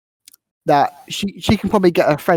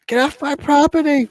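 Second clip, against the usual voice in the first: put-on screaming old lady; 11 semitones higher than usual. Old lady chasing off intruders, mock high-pitched scream. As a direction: imitate an angry old lady shrieking. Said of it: "the old lady's screaming".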